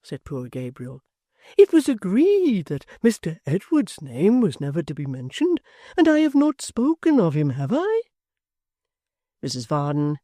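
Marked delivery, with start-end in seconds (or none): 1.6-8.0 a lightly voiced fond old father, wistful; kindly locksmith. A narrator's reading.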